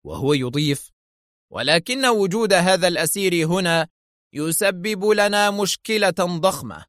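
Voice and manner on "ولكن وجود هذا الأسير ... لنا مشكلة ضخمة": high-pitched stern complaint, slowing at the end; stern anger. A narrator's reading. Director confronting the general, stern and angry. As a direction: lay down the problem with stern irritation. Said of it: "ثم استعاد صرامته الغاضبة دفعة واحدة".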